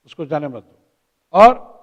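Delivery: ordinary delivery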